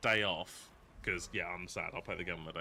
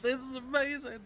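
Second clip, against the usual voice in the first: excited; 14 semitones higher than usual. Retold or imitated. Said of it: "I was like".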